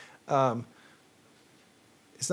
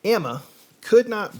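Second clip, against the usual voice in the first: put-on Southern accent, a bit louder than his chat; 5 semitones higher, 7 dB louder than usual. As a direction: read it like a Southern storyteller. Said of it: "it's been a week since I've done a Southern accent".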